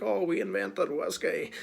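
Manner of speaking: a hint of a boastful Scottish drinker; mock Scots boast. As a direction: lightly imitate a proud Scot claiming credit. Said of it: "they're probably like".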